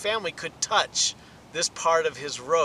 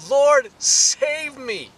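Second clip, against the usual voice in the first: slow, loud, higher plea; crying out; 7 semitones higher, 6 dB louder, much slower than usual. Acted. Peter sinking in the water, crying out loud. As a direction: cry out as you sink. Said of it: "he cried out".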